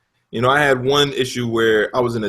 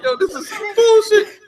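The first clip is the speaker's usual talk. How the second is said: laughing complaint. Retold or imitated. Complaining at game.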